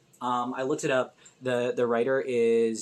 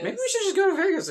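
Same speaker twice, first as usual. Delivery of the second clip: high, fast mocking echo of the line; 5 dB louder, faster than usual. Teasing a friend by echoing his Vegas line, high and fast. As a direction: parrot it back in a high silly voice.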